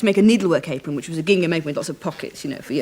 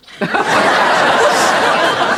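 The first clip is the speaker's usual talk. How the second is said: high airy laughter; wheezy high laugh; breathy laugh; 13 semitones higher, 5 dB louder than usual. Real, not acted. Laughing at the blooper story.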